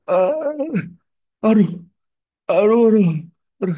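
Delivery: high, loud cries of pain; pained groaning. Retold or imitated. Preacher imitating a sick man's cries, pained groaning. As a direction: imitate a patient wailing "aduh" in bursts. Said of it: "Waktu dia sakit".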